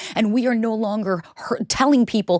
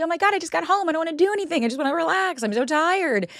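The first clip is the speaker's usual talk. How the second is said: higher, mock whine of a worn-out self; whiny tired self; 10 semitones higher than usual. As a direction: whine like you just dragged yourself home. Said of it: "I'm gonna be".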